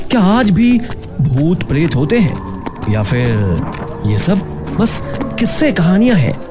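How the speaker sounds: usual speaking voice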